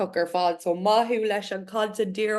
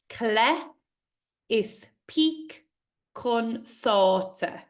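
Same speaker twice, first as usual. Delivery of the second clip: slow recitation again; 2 semitones higher, much slower than usual. Acted. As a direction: recite it once more, slowly. Repeats the line.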